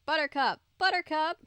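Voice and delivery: calling the dog's name. A narrator's reading. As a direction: shout the name into the woods. Calling for the lost dog.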